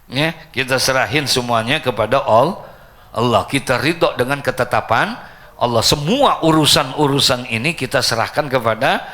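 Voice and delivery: loud, forceful sermon delivery; preaching. Real, not acted. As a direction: exhort the congregation at full volume. Preaches closing exhortation at full force.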